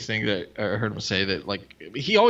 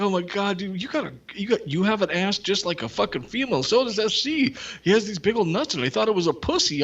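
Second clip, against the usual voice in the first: mock Scott; 9 semitones higher than usual. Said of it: "and Scott's like".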